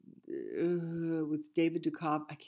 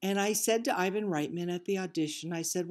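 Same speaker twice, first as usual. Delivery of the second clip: ordinary talk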